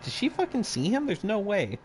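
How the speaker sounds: incredulous, voice jumping high; high disbelief. Real, not acted. Retelling disbelief.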